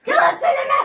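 loud accusing. Real, not acted. Accusing in game quarrel.

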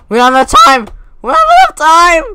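loud cry, climbing on the repeat; panicked shouting. Real, not acted. Player running out of time, panicked shouting. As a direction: shout it as time slips away.

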